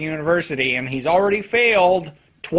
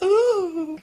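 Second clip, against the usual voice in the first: flirty coo; 13 semitones higher than usual. Retold or imitated. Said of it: "She's like".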